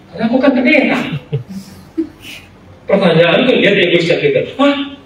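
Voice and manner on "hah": high, quick anxious asking; sudden worried plea; startled fear for himself; alarmed question. Retold or imitated. Fearing he is excluded too.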